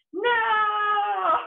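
high, loud, long mock cry of despair; drawn-out retold wail. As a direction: wail it out long, playing up the horror. Retelling panic at losing camera.

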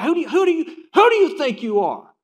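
loud, high, heated who do you think you are; a preacher hamming up an angry retort; mock angry shout. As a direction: bark it at someone overstepping. Acted argument, shouted quote.

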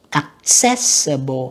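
slow Accessible with CESS stressed; second-syllable stress. Shown as a demonstration. Teacher marking word stress, Accessible slowly stressed on cess. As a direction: draw out Accessible, leaning on cess. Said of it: "âm hai ha".

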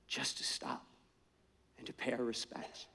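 respectful whispered plea; reverent whisper. Retold or imitated. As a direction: breathe it as a hushed whisper. Texan asks to honor the funeral.

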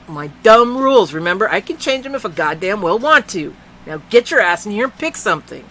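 loud, high furious rant; angry yelling. A narrator's reading. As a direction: lash out at the girl. Neil yelling at Melissa.

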